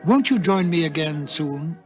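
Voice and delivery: usual speaking voice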